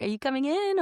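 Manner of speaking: high, light welcoming question; inviting. Retold or imitated. Voice world welcoming her in, light and inviting. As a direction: open the door and beckon her in. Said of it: "they were like".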